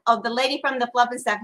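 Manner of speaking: ordinary delivery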